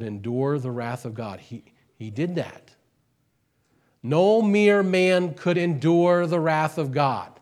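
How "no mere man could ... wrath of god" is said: loud, high, slow proclamation; forceful preaching. Acted. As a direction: hammer home NO to the hall. Sermon climax.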